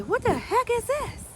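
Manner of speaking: high-pitched mock dismay at a bowl; retold disbelief. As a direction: act out being baffled, pitch way up. Acts out reaction to meatless bowl.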